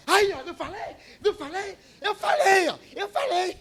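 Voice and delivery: high, loud laughing gloat; gloating man. Retold or imitated. Preacher imitating the stranger triumphantly laughing at the cashier, excited high gloat. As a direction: crow it with a laugh, I told you so.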